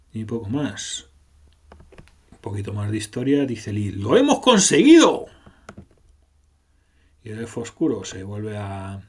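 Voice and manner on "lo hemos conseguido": high, loud victory cry; triumphant cheer. Acted. Lee cheers to the group that they won, in a high loud cry.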